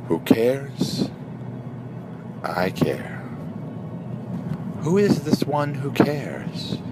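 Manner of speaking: slow, drawn-out meditation phrases with long pauses; guided meditation. Shown as a demonstration. Guide leading listeners through three breathing phrases, slow meditative delivery. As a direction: intone each question and answer slowly, leaving room to breathe.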